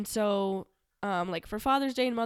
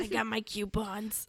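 put-on slow joking Scottish accent; smug thrifty Scot, unhurried; 4 dB quieter, slower than usual. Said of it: "But are you Scottish?".

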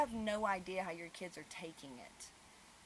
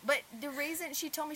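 usual speaking voice

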